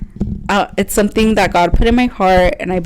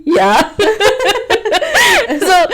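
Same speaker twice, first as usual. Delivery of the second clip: a high burst of laughter; delighted laugh; 14 semitones higher, 4 dB louder than usual. Real, not acted. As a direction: laugh with relief at good news. Retold job offer, bursts laughing.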